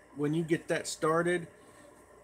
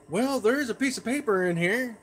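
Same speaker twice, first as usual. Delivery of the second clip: high, raised imitation of Mom; put-on mother voice, pitched well up; mom's reply; 7 semitones higher, 4 dB louder than usual. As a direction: answer as Mom, higher and a little louder. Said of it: "She goes".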